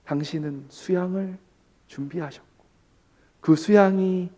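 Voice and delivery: ordinary delivery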